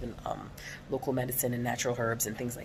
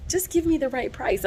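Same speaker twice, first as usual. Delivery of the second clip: higher, louder fed-up insistence; mock exasperated bargaining; exasperated demand; 10 semitones higher, 5 dB louder than usual. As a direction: push back at the seller, irritated. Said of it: "And I'm like".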